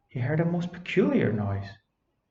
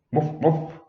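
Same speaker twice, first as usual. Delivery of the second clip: sad woofs; 3 semitones higher than usual. Acted. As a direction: bark mournfully like a sorry dog. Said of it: "said Bobs sadly".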